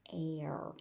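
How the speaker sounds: "Air" said softly on its own as a word ending; softly single out the vowel and R of "Air"; "Air" drawn out quietly to show the sound; isolated sound. Shown as a demonstration. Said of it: "particular features of pronunciation".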